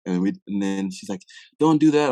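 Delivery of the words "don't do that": raised, pressing mom voice; a mother begging him not to do it; mom's urgent plea. Retold or imitated. Mom pleading over the phone.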